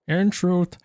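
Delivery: put-on high girly voice, cracking into laughter; girl voice. Streamer playing Jean explaining the trial, makeshift girl voice. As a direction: pitch it up as a makeshift lady. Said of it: "that's like my girl voice".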